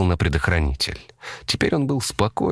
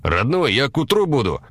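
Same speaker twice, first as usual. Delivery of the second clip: lightly voiced trucker, pitched higher; a hint of a higher-voiced trucker's radio call; 8 semitones higher than usual. A narrator's reading. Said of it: "искажённые помехами позывные какого-то дальнобойщика".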